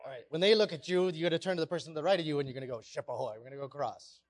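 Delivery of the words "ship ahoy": soft, low mention of the call; lowered, understated demo hail; quiet run-through. Shown as a demonstration. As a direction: say it softly, just marking it. Repeats the call while giving instructions.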